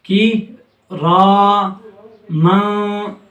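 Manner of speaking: "किरामा" stretched very slowly; slow recitation. Shown as a demonstration. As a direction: draw "किरामा" out evenly. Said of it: "अब देखें माँ किस तरीके से".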